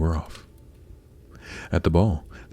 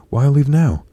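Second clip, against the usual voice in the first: high, louder pleading prince; raised, imploring storybook voice; urgent protest; 8 semitones higher, 8 dB louder, slower than usual. A narrator's reading. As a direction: protest her sudden departure. Prince begs Cinderella to stay.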